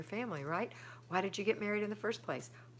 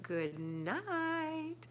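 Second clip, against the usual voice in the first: high, drawn-out sing-song good night; playful high goodnight; 11 semitones higher than usual. Shown as a demonstration.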